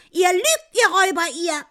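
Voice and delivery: high, loud accusing yell; angry shout. A narrator's reading. Wife cursing the thieving guests, furious high shout. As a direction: hurl the accusation at them. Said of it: "schreit die Frau".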